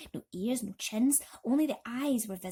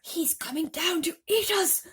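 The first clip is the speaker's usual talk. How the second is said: high loud terrified wail; old grasshopper wailing in fear; 9 semitones higher, 5 dB louder than usual. Acted. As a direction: wail it in panic. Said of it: "wailed the old green grasshopper".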